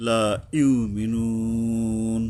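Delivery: লা ইউমিনুন with the vowel held three alifs long; long madd. Shown as a demonstration. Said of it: "এখানে ওই যে তিন আলিফ".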